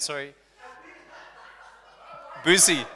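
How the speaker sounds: ordinary talk, nothing marked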